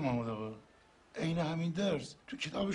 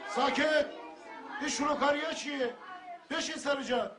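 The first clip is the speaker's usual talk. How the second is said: stern shout; 7 semitones higher than usual. Acted. Teacher silences noisy class. (فریاد محکم معلم برای ساکت کردن کلاس)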